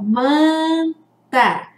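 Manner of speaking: "manta" with a held nasal first vowel; som nasal. Shown as a demonstration. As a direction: stretch the nasal "man" in "manta", then "ta". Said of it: "Olha o som nasal". ("manta" com o "man" bem nasalizado)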